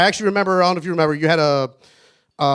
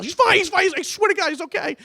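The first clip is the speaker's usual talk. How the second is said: very high, hurried insistence; frantic reassurance; 12 semitones higher than usual. Acted. Speaker reassuring worried Alana about Keith, frantic and high. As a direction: swear it's okay in a mock-panicked high voice.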